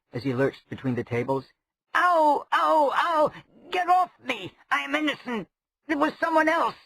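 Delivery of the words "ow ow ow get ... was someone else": high, frantic yelps of pain; panicked yelping. A narrator's reading. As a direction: yelp as if stung. Chimwazzle attacked by invisible stingers.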